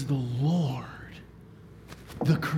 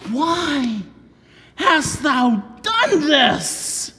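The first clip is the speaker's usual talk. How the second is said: higher, louder, very slowly drawn out; alarmed question; 10 semitones higher, 5 dB louder, much slower than usual. Acted. Sailors demand of Jonah, loud and drawn out. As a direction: stretch out the accusing question. Mariners' cry voiced.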